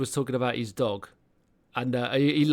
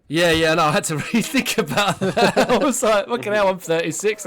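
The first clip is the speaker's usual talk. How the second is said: loud, high, talking through laughter; laughing realisation; 6 semitones higher, 6 dB louder than usual. Real, not acted. Laughing as he gets the joke.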